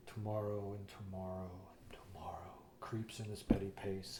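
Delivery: mock a hammy student actor, low and breathy; whispered tragic Shakespeare, overdone; very dramatic classmate. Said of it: "he does this very dramatic".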